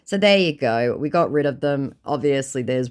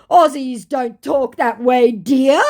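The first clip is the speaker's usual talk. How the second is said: slow, high, loud with rising end; condescending scammer; 11 semitones higher, 7 dB louder, slower than usual. Acted. Fake Tim correcting an Aussie, mock condescension. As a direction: correct them in a drawn-out mock voice.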